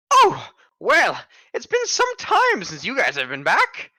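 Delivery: put-on high chirpy old woman; a fussy old shopkeeper greeting; old lady voice.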